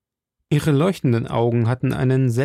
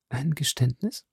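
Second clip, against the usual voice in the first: softer, rising "Ein Geständnis?" with weight on "Ein"; a quiet, alarmed echo; hushed wary question; 3 semitones higher, 7 dB quieter than usual. Acted. Milady's startled question.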